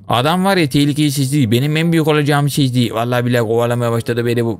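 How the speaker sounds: ordinary delivery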